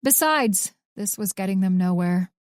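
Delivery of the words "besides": ordinary delivery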